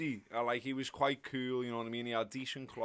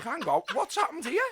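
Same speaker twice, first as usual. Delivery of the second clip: high, louder startled question; retold surprise; 12 semitones higher, 5 dB louder than usual. Fan back at his seat beside Neil, retold surprise. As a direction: raise the pitch as if caught off guard.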